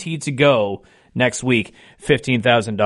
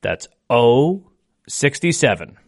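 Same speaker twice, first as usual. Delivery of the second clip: slow, deliberate number calling; bingo caller; slower than usual. Acted. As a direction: call each ball slowly with falling ends. Calls each bingo ball twice.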